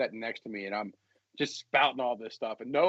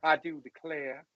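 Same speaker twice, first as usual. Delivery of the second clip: put-on slow Southern belle accent; 2 semitones higher, slower than usual. A Southern belle line to the cohost, slowly. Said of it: "throw the Southern accent on it".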